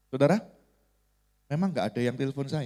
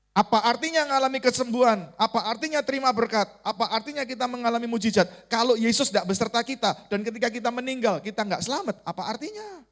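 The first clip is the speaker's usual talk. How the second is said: higher-pitched, insistent rhetorical questions; impassioned preaching; 9 semitones higher than usual. Real, not acted. Preacher challenges congregation about salvation, insistent and high.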